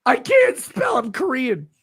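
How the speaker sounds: shrieked high mock answer; mocking the fan, yelled way up high; mock shout. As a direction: screech the silly excuse. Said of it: "Have you tried calling nine one one".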